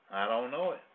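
ordinary talk

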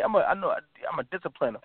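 ordinary delivery

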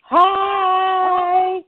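shouted hello. Real, not acted. Guest calling out on joining.

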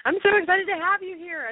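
high, gushing fan excitement; giddy anticipation. Real, not acted. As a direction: gush like meeting a celebrity. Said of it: "forgive my giddiness and my giggles".

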